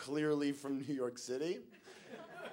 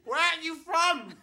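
put-on high-pitched drunk girl yelling; mock screaming; 4 dB louder than usual. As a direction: scream it as if at someone hard of hearing. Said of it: "she starts screaming".

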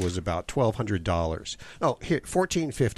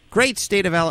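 excited echo; 8 semitones higher, 4 dB louder than usual. Real, not acted. Host echoes caller's phrase.

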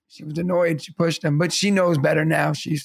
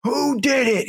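higher-pitched mock yell; retold scream; 8 semitones higher, slower than usual. Bus driver demanding who planted the stink bomb, retold scream. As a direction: imitate an outraged shout without full volume. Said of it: "He was screaming".

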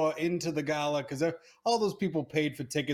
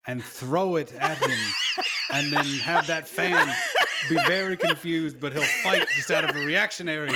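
laughing through it; 2 semitones higher, 5 dB louder than usual. Real, not acted. Pitching a silly in-character plan.

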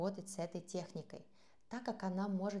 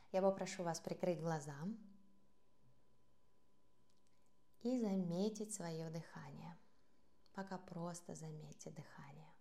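guided meditation; 8 dB quieter than usual. Acted. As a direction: ease them into the breath, softly. Leads a breathing practice. (мягкий голос медитации)

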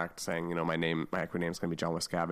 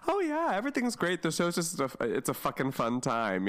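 chipper TV guest; 9 semitones higher than usual. Retold or imitated. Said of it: "you know".

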